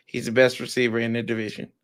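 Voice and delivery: low, soft, half-hearted statement; unconvincing claim. Real, not acted. Backing his receiver to a co-host, low and unconvincing. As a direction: say it small and low, without conviction. Say it with your chest.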